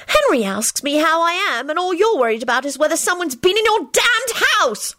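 put-on high, angry girlish shriek. A narrator's reading. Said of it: "she shrieked".

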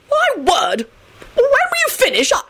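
high, fussy impatience; irritable. A narrator's reading. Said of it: "he said irritably".

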